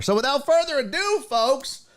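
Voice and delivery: high, rousing radio host intro; show announcer. Acted. Radio host launching the sports segment, high announcer delivery.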